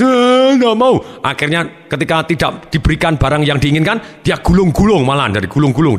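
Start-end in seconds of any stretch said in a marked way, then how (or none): 0.0-1.0 high, loud mock wail; imitated kid's tearful whine; crying child. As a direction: bawl a refusal like a small child. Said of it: "ketika dia nangis".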